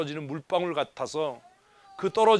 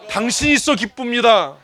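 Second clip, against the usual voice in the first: louder, bright proclaiming of happiness; joyful declaration; 5 semitones higher, 9 dB louder than usual. Shown as a demonstration. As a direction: declare it with full joy. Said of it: "하십시다".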